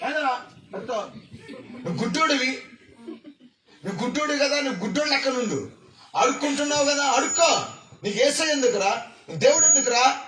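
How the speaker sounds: mocking bystanders. Bystanders scolding the blind beggar, lightly mocking. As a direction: tease him like a rude crowd. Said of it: "అని తిట్టాడండి".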